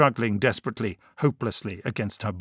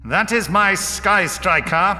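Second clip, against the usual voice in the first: high, loud, slow proud declaration; ringing pride; 9 semitones higher, 6 dB louder, slower than usual. A narrator's reading. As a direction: boast it grandly, drawing out the words. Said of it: "he said proudly".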